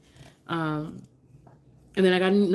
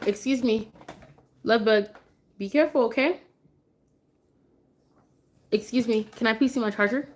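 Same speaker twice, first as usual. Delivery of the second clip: sweet mom voice; 4 semitones higher than usual. Real, not acted. Mom sweetly asking her toddler for the charger.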